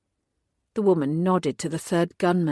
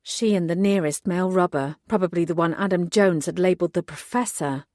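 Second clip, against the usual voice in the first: plain reading voice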